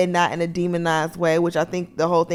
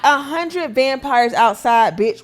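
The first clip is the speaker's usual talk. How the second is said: mock outrage; 6 semitones higher, 4 dB louder than usual. Mock yelling at Pearline.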